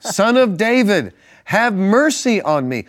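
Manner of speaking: high, loud and slow pleading cry. A narrator's reading. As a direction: stretch the plea out high and loud. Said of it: "he only shouted louder".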